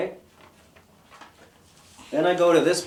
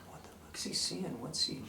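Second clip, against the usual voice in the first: whispered aside; 3 semitones higher, 14 dB quieter than usual. Retold or imitated. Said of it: "And I'm like".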